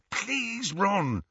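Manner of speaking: high, hushed begging; urgent plea. A narrator's reading. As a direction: plead without being overheard.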